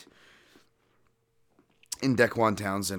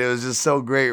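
usual speaking voice

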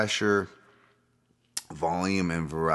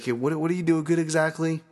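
higher put-on mocking voice; mocking question; 8 semitones higher than usual. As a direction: tease a fighter about his skills. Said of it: "some guys like".

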